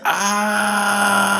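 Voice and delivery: mock scream, high and drawn out; high pained yell. Man retelling a painful moment, high yell. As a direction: cry out high as if in pain. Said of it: "I start to scream".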